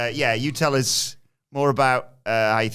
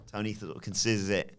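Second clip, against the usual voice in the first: ordinary delivery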